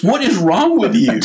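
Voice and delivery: high-pitched mock exasperation; imitated scolding dad. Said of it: "my dad grabs me and takes me outside and goes".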